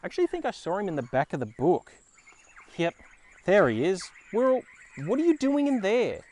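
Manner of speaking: usual speaking voice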